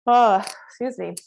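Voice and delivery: talking through a yawn; words stretched by a yawn. Real, not acted. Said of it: "yawn comes when you release".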